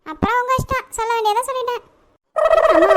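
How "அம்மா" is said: calling mom loudly. Acted. Child calling out to mother.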